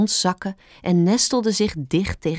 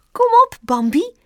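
very high, loud little rabbit call; Stampertje calling out; 6 dB louder than usual. A narrator's reading. Rabbit urging the fawn along, calling out. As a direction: call your friend out to play. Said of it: "riep Stampertje".

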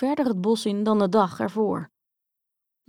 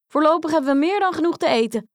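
much higher, louder, fast and elated; joyful cry; 7 semitones higher, 5 dB louder, much faster than usual. Acted. Grietje shows father the sweets, crying out joyfully and fast. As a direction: burst out happily. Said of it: "riep Grietje".

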